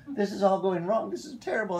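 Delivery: high-pitched put-on complaint; hammed-up high grumbler; mock complaining. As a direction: imitate a caller complaining, pitched well up. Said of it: "they'll call me up to complain".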